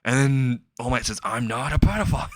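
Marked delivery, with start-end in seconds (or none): 1.2-2.3 half-hearted retold scream; mild mock yell. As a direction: act out a scream without full volume. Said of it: "He screams it out loud".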